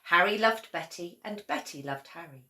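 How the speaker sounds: plain reading voice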